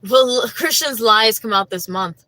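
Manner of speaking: loud, deliberate re-read with "this month"; corrected reading. Shown as a demonstration. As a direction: set the record straight, emphatic. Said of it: "I don't know why I read that".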